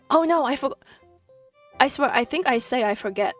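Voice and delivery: ordinary delivery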